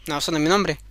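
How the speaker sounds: low, smug refusal; the goblin rejecting the queen's guess. Acted. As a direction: answer low and unbothered.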